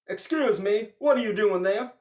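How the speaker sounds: loud, high startled question; old man surprised to find Klaus in his barn. Acted. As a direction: challenge the stranger loudly. Old man finding stranger in barn.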